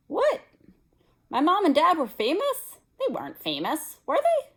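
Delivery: high, loud astonished questions; shocked. A narrator's reading. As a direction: gasp it out in disbelief. Harry shocked by news.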